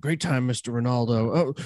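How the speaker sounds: ordinary talk, nothing marked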